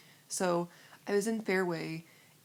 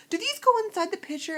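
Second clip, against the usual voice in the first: high, loud mock puzzlement; exaggerated high-pitched question; imitated clueless cashier; 14 semitones higher, 8 dB louder than usual. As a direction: ask like an over-curious cashier.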